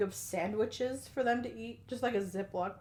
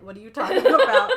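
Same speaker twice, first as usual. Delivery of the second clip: laughing disbelief; 11 semitones higher, 15 dB louder than usual. Retold or imitated. Acted Beth quarrel, laughing.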